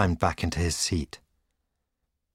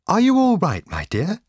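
high, loud anxious question; worried cry; 12 semitones higher, 5 dB louder than usual. A narrator's reading. Wife runs out to meet her husband, crying out. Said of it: "she cried".

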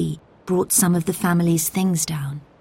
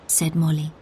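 plain reading voice

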